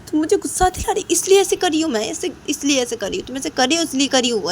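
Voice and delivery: high-pitched cross retort; angry yelling. Acted. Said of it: "ऐसा चिल्ला रही हूँ". (गुस्से भरी ऊँची आवाज़)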